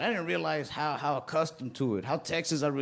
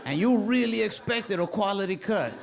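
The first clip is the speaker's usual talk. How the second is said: higher and higher mock rant; 5 semitones higher than usual. Kid barber ranting at a customer, mock outrage. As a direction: go off on him, laughing. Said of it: "I'm like".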